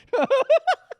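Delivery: sudden loud high laugh; high burst of laughter. Real, not acted. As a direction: crack up at the headline. Laughing at the article.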